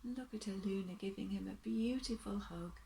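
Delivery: gentle bedtime story reading, rising and falling; storybook narration. A narrator's reading. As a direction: read it like a picture book to little ones.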